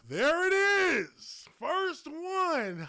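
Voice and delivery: high, loud, stretched-out exclamation; delighted holler on finding it; excited shout. Real, not acted. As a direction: crow it at a high pitch. Finds the picture he searched for.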